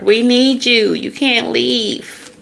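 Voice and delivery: high, drawn-out needy plea; mock pleading. Family begging the star not to leave, high mock pleading. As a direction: beg in an exaggerated high voice.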